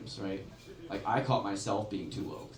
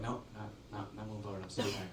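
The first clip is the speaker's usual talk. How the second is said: much softer, mumbled refusal; stubborn refusal; 3 semitones lower, 11 dB quieter than usual. Retold or imitated. Stubborn people refusing progress, soft mutter. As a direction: dig in and mutter no.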